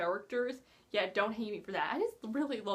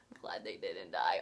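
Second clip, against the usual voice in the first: much lower, breathless whisper of relief; 4 dB quieter than usual. Real, not acted. Relieved aside.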